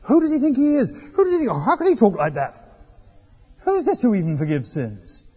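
hammed-up scandalized dinner guests; outraged guests. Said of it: "and there's uproar".

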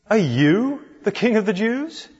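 you lifted high and leaned on; stress on YOU. Shown as a demonstration. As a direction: hit the word you, surprised. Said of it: "the emphasis on the question is on the word you".

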